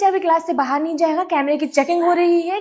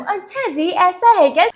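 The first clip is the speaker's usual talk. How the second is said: meme line; 2 semitones higher than usual. Acted. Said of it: "अपना एक favourite meme बताओ".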